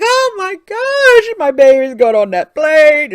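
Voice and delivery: mock-panicked crying over her baby; panicked mother. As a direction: wail it like a frantic mom. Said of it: "and I'm like".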